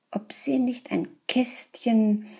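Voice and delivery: usual speaking voice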